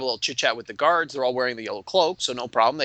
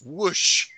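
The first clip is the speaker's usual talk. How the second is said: drawn-out fiery rush, slightly raised; imitated whoosh; 4 semitones higher than usual. Game master showing fire arc in the distance, whooshing. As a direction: stretch the word into a burst of flame.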